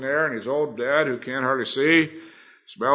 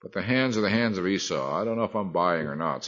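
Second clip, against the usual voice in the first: skeptical imitation; 4 dB quieter than usual. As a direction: imitate an arguer who isn't convinced. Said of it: "sounds a little fishy, they'll say".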